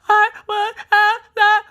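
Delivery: high mock sobs, one word per breath; upset crying child. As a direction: imitate a kid too upset to talk. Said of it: "every breath they can only say one word".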